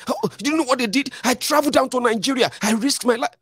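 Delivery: high, whiny mock grievance; bitter ex-supporter. Vlogger mimicking angry ex-supporters: high, whining. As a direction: mimic the disillusioned voter ranting. Mocking disappointed former supporters.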